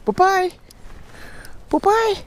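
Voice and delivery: very high squeaky goodbye; squeaky praising a dog. Real, not acted.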